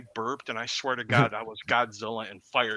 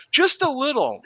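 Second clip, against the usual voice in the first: much higher, small pleading voice; coaxing friend; 13 semitones higher, 4 dB louder than usual. Retold or imitated. Friend begging for a taste, much higher pleading. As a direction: beg for just a bit. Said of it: "You really don't want to".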